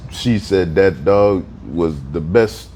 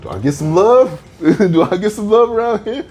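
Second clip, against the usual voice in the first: high whiny plea with a laugh; jealous new dad; 13 semitones higher than usual. Retold or imitated. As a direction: beg for attention, laughing. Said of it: "he was like".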